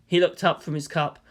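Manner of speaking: usual speaking voice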